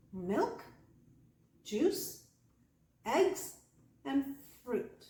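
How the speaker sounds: "milk", "juice", "eggs" each rising, "fruit" falling; exaggerated list intonation. Shown as a demonstration. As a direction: pause after each rising item, then fall on "fruit". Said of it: "this is what's going on".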